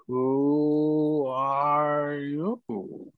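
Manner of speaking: very slow, high, unearthly wail; ghostly high moan. Acted. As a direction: stretch it out like a lost spirit. GM voices a strange spirit again.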